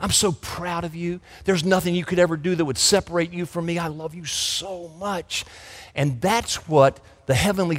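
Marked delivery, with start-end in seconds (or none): 0.0-1.2 put-on soft, tender words to a child; tender father. A loving daddy speaks to child. 1.5-5.5 soft and hushed, fading to near quiet; tender father. Acted. A loving daddy speaks to child.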